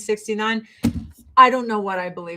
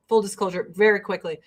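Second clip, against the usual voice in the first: ordinary talk, nothing marked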